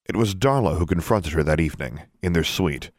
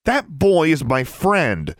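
high, slow, emphatic anger; angry retort; 10 semitones higher, 4 dB louder, slower than usual. A narrator's reading. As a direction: defend your friend, drawing out each word. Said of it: "she retorted angrily".

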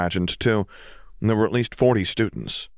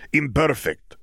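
put-on slow, heavy Eastern European accent; Bulgarian accent; 8 semitones higher, slower than usual. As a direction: speak slowly in Krum's Bulgarian accent. Krum ends his apology.

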